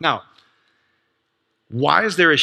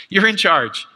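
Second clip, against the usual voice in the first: laughing delivery; 5 semitones higher, 3 dB louder, slower than usual. Acted. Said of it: "He says, "I've given this over to you".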